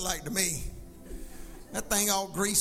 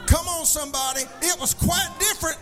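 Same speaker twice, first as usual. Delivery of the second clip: loud, higher, shouted sermon climax; preaching at full force; 6 semitones higher, 7 dB louder than usual. Real, not acted. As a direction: preach it big and rising.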